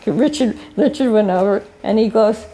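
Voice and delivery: normal talking voice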